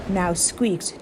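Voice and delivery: usual speaking voice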